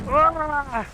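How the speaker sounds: long open "Ah" yell, just for fun; mock yell let loose as a joke; joke scream. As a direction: let out a playful scream on request to yourself. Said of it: "Pasigaw naman ako".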